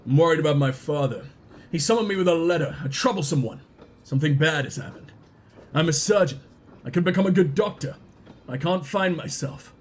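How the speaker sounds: low, sombre character voice; troubled young surgeon. Acted. Surgeon confiding fears about his father, low troubled voice. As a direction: confess it low and earnest.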